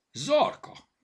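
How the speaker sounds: high, loud accusing call; shout. A narrator's reading. Bogi rounds on Zorka over the torn page, shouting. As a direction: shout the name, pitch jumping up. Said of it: "kiáltotta Bogi".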